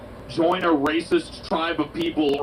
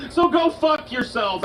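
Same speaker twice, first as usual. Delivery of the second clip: usual speaking voice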